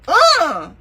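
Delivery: an irritated huff as she snatches her leg away; annoyed grunt. Retold or imitated. Wife fed up with husband's weird joke, an annoyed grunt. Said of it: "I'm annoyed".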